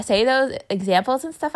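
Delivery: ordinary delivery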